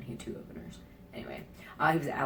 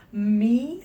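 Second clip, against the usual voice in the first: loud, high, drawn-out question; mock flattered surprise; 6 semitones higher, 12 dB louder than usual. Told her puns are hilarious, mock flattered surprise. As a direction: play up being taken aback by praise.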